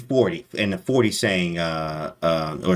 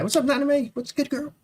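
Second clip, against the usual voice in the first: very high, cutesy put-on voice; squeaky greeting. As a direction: greet her in a tiny squeaky voice. Greeting a viewer in chat.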